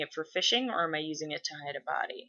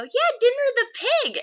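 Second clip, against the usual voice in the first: put-on very high, loud child voice; eager little kid; 7 dB louder than usual. Little girl prompting the neighbors about their pig, eager squeal. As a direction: pipe up like an insistent small child.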